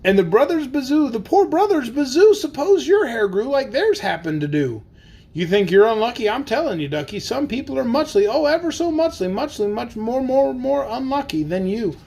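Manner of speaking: lively, sing-song storybook reading; bouncy picture-book narration; reading to children. Acted. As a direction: read the rhyme aloud for the kids. Reads Seuss aloud to kids.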